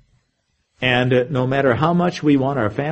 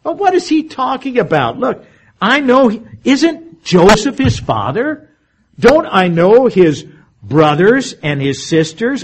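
high, incredulous grumbling of the doubters, much higher pitch; complaining crowd; 8 semitones higher, 4 dB louder than usual. Retold or imitated.

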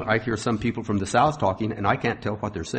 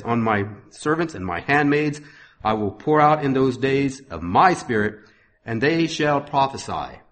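raised, ringing sermon reading voice; scripture reading; 4 semitones higher, 5 dB louder than usual. Acted. Preacher reading Acts to his congregation, proclaiming.

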